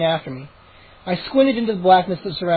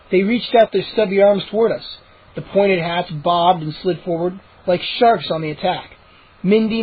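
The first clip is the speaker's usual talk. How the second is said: plain reading voice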